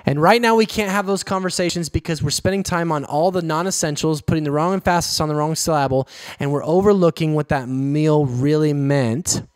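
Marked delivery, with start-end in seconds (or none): 4.2-6.1 quick em-FASS-is on the wrong syl-LAB-le; fast, with second-syllable stress on emphasis and syllable; misplaced word stress. Retold or imitated. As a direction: rattle it off, misstressing emphasis and syllable. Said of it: "was Mike Myers quote".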